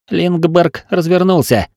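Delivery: high, slow, carrying report; urgent report. A narrator's reading. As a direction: call out news to the captain. Lookout reports during the battle.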